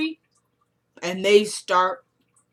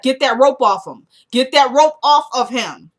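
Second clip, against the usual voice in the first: angry command; 4 semitones higher, 6 dB louder than usual. Retold or imitated. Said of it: "I appreciated the anger in Lucian's voice".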